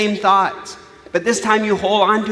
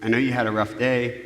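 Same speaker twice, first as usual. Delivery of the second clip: gentle parent; 7 semitones lower, 5 dB quieter than usual. Shown as a demonstration. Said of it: "You might say".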